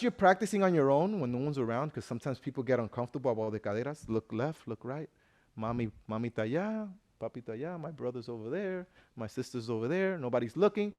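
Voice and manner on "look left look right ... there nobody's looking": soft, lowered sneaky voice; furtive aside. Acted. Dancer checking that relatives aren't watching before practising, furtive. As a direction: keep it hushed, as if checking who's around.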